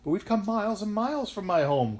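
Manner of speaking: put-on high, loud little-girl protest; distressed cry. A narrator's reading. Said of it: "cried the little girl".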